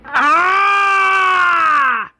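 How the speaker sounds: long high-pitched scream of agony; acted loud, drawn-out scream when bitten; pain scream. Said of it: "Doctor Stevens screams".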